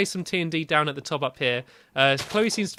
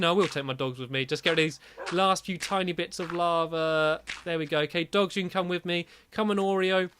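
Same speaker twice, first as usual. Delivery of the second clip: normal talking voice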